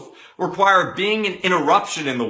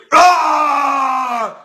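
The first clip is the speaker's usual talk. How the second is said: high, loud, drawn-out yell; acted scream aimed at mom; wordless outburst scream; 8 semitones higher, 6 dB louder than usual. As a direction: let out a long mock roar. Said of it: "but the feeling is".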